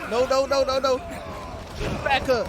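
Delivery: loud panicked no's; being chased. Real, not acted. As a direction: panic as they close in. Enemies closing in.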